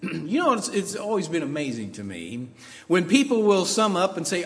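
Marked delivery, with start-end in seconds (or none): none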